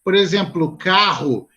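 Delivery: ordinary delivery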